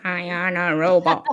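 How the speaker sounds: whispered mock robot voice. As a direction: play along as a toy robot. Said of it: "Ian, you've gone robotic".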